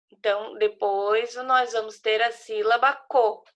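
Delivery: normal talking voice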